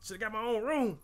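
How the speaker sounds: high, loud shoo-away yell. Retold or imitated. Player yelling at the bats to back off. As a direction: shove them off, exasperated. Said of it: "And you like".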